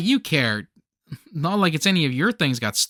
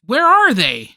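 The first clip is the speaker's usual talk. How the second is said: loud, raised-pitch demand; alarmed demand; 6 semitones higher, 6 dB louder, slower than usual. Acted. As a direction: cry out in panic over missing gifts. Panic over missing gifts.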